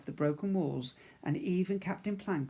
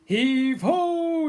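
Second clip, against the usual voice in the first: high, slow, loud shout; shouted heave-ho; 12 semitones higher, 11 dB louder, much slower than usual. Acted. Pirates heaving the chest, high, slow, loud shout. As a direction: haul it out like sailors lifting. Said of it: "they shouted".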